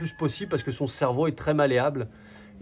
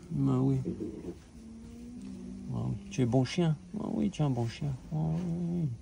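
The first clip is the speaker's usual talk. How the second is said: talking to a dog; 8 dB quieter than usual. Real, not acted. Praising the dog softly.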